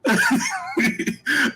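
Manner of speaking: high-pitched helpless giggle; real, high giggling laugh. Real, not acted. Host laughs at the played clip.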